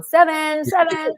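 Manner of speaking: playful imitation of a host's cue; mimicking Jeff. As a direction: call out a time warning, hammed up. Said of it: "poor Jeff is up there going".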